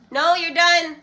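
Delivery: loud, high, firm no across the room; firm call to daughter. Real, not acted. Mother telling her daughter to stop feeding the dog, loud firm call.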